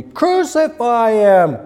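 loud, high, drawn-out crowd shout; full-force mob cry, stretched out; crying out exceedingly. Acted. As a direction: bellow it slowly like a demanding mob. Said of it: "they cried out the more exceedingly".

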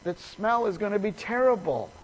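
high, loud, worked-up protest; excited. Retold or imitated. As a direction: blurt it out in a panic about the smell. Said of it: "got all excited and she says".

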